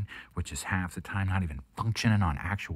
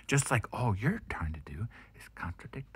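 usual speaking voice

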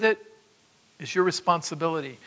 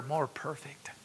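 whispered aside; 5 semitones lower, 12 dB quieter than usual. Acted. Softened aside.

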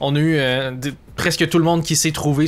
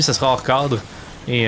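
ordinary talk, nothing marked

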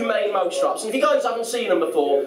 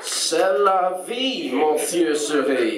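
slow, drawn-out mock French; French punchline, very slow; 4 semitones lower, much slower than usual. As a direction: draw it out in hammed-up French. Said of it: "So I got the French dictionary".

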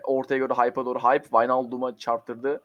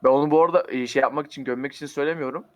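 normal talking voice

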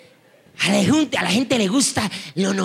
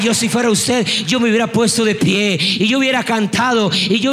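driving, full-voiced revival sermon; fervent preaching; 4 dB louder than usual. Real, not acted. Preacher stirring the congregation to praise, fervent full-force preaching. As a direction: build phrase on phrase with rousing force.

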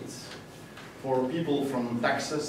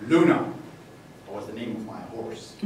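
usual speaking voice

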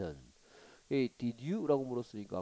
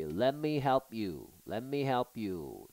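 slow clear reading; 3 dB louder, much slower than usual. Shown as a demonstration. Teacher reads dialogue slowly.

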